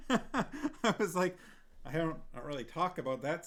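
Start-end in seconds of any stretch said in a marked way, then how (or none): 0.0-1.4 laughing hard. Real, not acted. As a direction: bursts of laughter.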